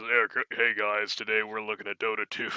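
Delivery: low, put-on caster voice; mock big boy voice. Teasing sick co-caster about his voice, mock deep big boy voice. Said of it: "doing one of those big boy voices".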